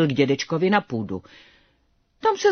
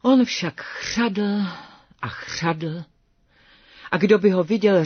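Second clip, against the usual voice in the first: ordinary delivery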